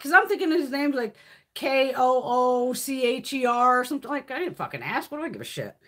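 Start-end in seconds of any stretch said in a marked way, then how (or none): none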